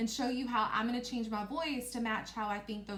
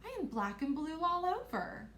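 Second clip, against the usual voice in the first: high storybook princess; 6 semitones higher than usual. Acted. Princess complaining to her hosts, high-voiced. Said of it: "I made my voice go up".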